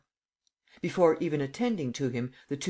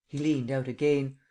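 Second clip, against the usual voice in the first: plain reading voice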